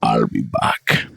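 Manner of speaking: very low, slow cyborg voice; deep, deadpan action-hero quote; movie robot catchphrase. Retold or imitated. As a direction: drop it deep and drag it out like a killer robot. Quoting the Terminator line.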